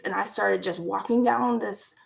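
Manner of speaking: normal talking voice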